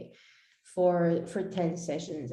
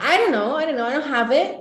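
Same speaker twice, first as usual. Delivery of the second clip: flustered outburst; 8 semitones higher, 8 dB louder, faster than usual. Retold or imitated. Flustered client answer.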